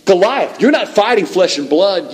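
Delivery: high-pitched, insistent challenge; calling out defiantly. Acted. David confronts Goliath before the battle, calling out defiantly. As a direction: call the giant out by name and set him straight.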